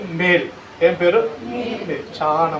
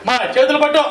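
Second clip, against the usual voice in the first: higher, louder order; commanding call; 8 semitones higher, 6 dB louder than usual. Retold or imitated. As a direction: bark the order to hold hands. Old man commanding. (చేతులు పట్టమని గట్టిగా ఆజ్ఞ)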